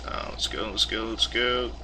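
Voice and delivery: soft, impatient muttering. Real, not acted. Urging slow page, muttered.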